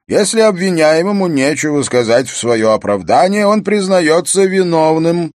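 high, loud formal pronouncement; accusing court official. Acted. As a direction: declare it to the whole hall as a prosecutor. Chamberlain prosecuting before the king.

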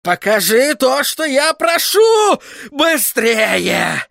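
high, loud, furious order; impatient shout. A narrator's reading. Sorcerer demanding his crystal obey, shouting impatiently. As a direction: yell the command at full pitch. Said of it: "с нетерпением замахал руками".

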